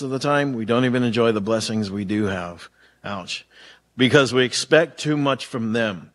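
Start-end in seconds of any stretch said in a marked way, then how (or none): 3.0-3.5 soft wry ouch under the breath; a quiet self-deprecating ouch. Real, not acted. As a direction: let the point sting quietly. Reacting to own sermon point.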